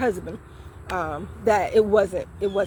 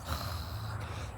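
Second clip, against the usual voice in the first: imitated disdainful exhale; 16 dB quieter than usual. Imitating the man's look.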